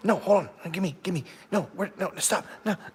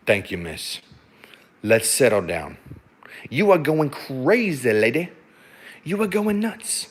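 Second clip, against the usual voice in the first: soothing dog talk; 4 dB quieter than usual. Real, not acted. Owner soothing excited husky.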